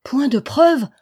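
high, loud incredulous question; dumbfounded outcry; astonished exclamation. A narrator's reading. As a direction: throw it back in amazement. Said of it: "s'écria Pélo ébahi".

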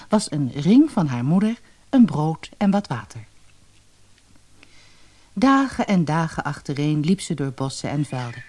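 usual speaking voice

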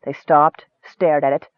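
plain reading voice

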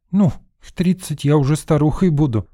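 put-on high, lively girlish retort; light, laughing-toned protest; amused. A narrator's reading. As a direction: toss it off with amusement, pitch up. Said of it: "рассмеялась девчонка".

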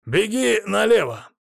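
high, drawn-out call. A narrator's reading. Familiar shouting directions from above the roofs, calling out.

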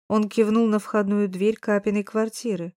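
ordinary delivery, nothing marked

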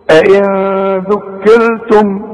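slow recitation with hidden nasal n; tajweed recitation. Shown as a demonstration. Teacher demonstrating ikhfa to students, slow recitation. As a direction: chant أئن ذكرتم slowly, hiding the نون with ghunna. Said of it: "شوف".

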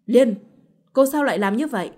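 shouting at his wife after slapping her; angry shout. A narrator's reading. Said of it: "rồi quát". (quát lớn giận dữ)

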